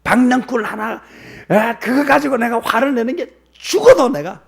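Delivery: high, loud, indignant outburst; brazen anger. Acted. Jonah retorts to God over one gourd vine, brazen. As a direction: protest as if scandalised by the question. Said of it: "이게 겁을 상실해".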